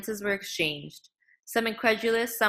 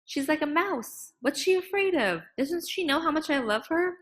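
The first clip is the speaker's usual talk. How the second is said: pained bewilderment; 8 semitones higher than usual. A narrator's reading. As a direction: ask it like a wounded father. Father hurt by daughter's fear.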